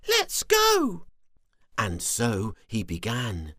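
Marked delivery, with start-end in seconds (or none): 0.1-1.1 very high eager little worm; small worm voice. Acted. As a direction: chirp it out eagerly. Squiggy eager to go.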